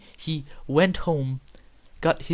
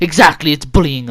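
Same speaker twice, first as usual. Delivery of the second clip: loud triumphant retort, imitating a teacher; mock accusing outburst; 2 semitones higher, 12 dB louder than usual. Staff member declares it bullying, loudly triumphant. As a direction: jump on the admission at volume. Teacher pounces on second admission.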